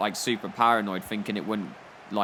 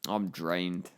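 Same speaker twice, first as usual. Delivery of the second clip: soft, lower, worn-out mock sigh; quietly imitating a defeated boss; drained and sad; 5 semitones lower, 6 dB quieter, much slower than usual. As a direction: play the beaten manager giving up, softly. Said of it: "really quite sad".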